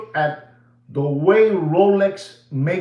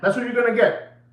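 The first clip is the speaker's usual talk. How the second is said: ordinary delivery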